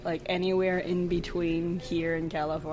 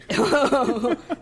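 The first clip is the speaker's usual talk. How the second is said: usual speaking voice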